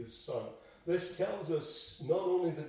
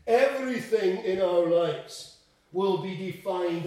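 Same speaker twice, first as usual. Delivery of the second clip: louder, driven-home "Everything in our lives"; forceful preacher's stress on "Everything"; emphatic restatement; 2 semitones higher, 7 dB louder than usual. Real, not acted. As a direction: hammer "Everything" loudly from the pulpit. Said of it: "needs to be emphasized".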